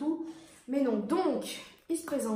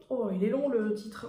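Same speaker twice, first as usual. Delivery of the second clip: ordinary delivery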